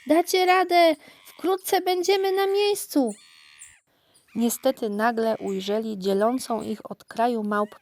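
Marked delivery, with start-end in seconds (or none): 0.1-3.1 high, loud monkey voice urging them on; rally the fleeing group, stretching the last words; cheering on. A narrator's reading. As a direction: call out encouragement in a high-pitched character voice. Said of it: "skutecznie ich dopingowała, wołając".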